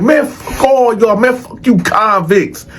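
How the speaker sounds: high-pitched, mock-defiant tirade; acted-out scornful boasting; talking big trash. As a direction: imitate someone cussing out the whole block on his way out. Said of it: "Jimmy just talking big shit".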